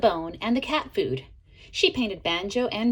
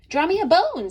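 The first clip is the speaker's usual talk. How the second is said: dog asking; 8 semitones higher, 6 dB louder than usual. A narrator's reading. Dog character asks pencil.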